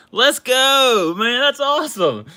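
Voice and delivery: high, loud cheer; excited cheer. Real, not acted. After reading a friend's kind letter, loud high cheer. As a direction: celebrate it out loud.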